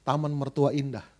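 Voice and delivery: ordinary delivery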